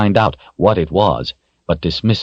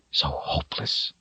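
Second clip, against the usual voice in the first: whispered despair; 11 dB quieter than usual. A narrator's reading. Despair in narration.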